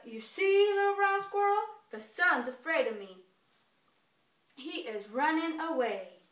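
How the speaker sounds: loud, boastful bear voice; gloating bear. Acted. Bear gloating to squirrel as sun sets, loud boast. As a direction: crow over the small doubter. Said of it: "he's like".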